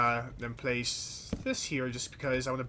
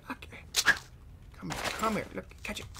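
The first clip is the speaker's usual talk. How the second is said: usual speaking voice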